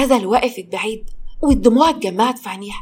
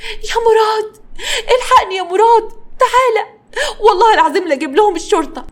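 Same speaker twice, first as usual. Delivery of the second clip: high, loud distressed cry for help; shrieked appeal, very high and loud; loud yelling; 11 semitones higher, 5 dB louder than usual. A narrator's reading. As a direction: holler for your husband in outrage. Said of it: "بدأت تزعق بصوت عالي".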